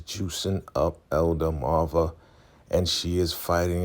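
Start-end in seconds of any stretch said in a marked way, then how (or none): none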